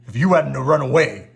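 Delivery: loud sulky grumble; sulking. A narrator's reading. Beast sulking at Belle while bandaged. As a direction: blame her like a pouting child. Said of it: "sulked the beast".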